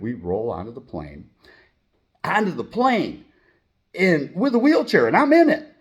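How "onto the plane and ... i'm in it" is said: excited. Retold or imitated. Excited rolling onto the plane.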